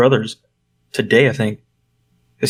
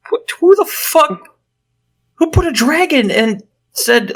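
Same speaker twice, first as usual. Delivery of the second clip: high-pitched, sputtering disbelief; baffled outrage; slower than usual. Real, not acted. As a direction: sputter it out, aghast and amused. Ranting in disbelief at the scene.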